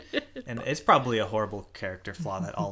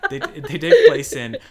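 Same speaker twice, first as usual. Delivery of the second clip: laughing; 16 dB louder, slower than usual. Real, not acted. Laughing at the Fargo fact.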